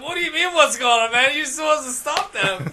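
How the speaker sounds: mock exasperated protest; incredulous retort. Incredulous retort in retold exchange.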